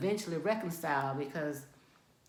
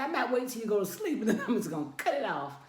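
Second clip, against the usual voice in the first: laughing threat; 3 semitones higher, faster than usual. Retold or imitated.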